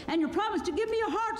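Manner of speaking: very high, quick, eager voice; Tin Woodman. A narrator's reading. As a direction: chime in fast and high-pitched. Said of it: "said the Tin Woodman".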